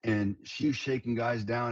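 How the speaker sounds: normal talking voice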